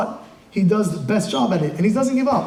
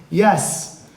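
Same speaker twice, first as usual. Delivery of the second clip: ordinary talk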